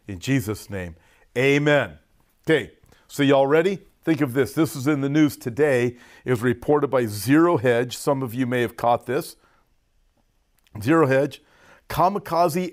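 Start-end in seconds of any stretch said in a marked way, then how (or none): none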